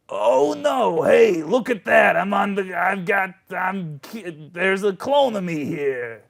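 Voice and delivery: high, loud, put-on impostor; bad doppelganger voice. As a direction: overdo a poor imitation of a friend. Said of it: "Has Adino always talked like this".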